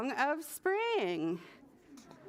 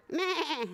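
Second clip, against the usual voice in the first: short, higher-pitched sheep bleat; sheepish bleat; 5 semitones higher than usual. A narrator's reading. Little bird trying another call, sheepish bleating. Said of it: "sheepishly cried out".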